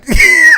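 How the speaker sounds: high shrieking laugh; burst of laughter. Real, not acted. Vlogger cracking up at Ava's comeback, shrieking laugh. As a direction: crack up hard.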